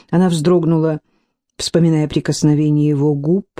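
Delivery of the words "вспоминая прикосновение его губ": usual speaking voice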